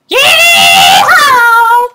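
high loud cowboy whoop; yeehaw yell. Real, not acted. Jumping in game.

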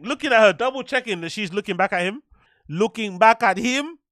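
slipping into an African accent. Shown as a demonstration. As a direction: lean into an African lilt. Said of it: "I sounded kinda African there".

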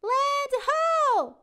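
very high, loud shout; lookout shout. Acted. Callie spots the island, loud shout. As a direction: cry it out from the lookout. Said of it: "yells First Mate Callie".

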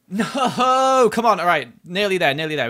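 high, loud, frantic urging; will yourself over the last jump. Real, not acted. Player urges himself on mid-jump, frantic and loud.

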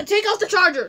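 loud. Real, not acted. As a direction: call it out loud and quick. Urgent instruction to friend.

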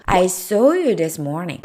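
each word slow and clear; slow model sentence. Shown as a demonstration. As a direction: spell it out slowly for learners. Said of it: "I see 하고 I saw". (천천히 또박또박 예문 읽기)

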